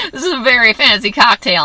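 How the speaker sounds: a real laugh running through the line. Real, not acted. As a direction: still giggling through the words. Laughing at the drug cocktail.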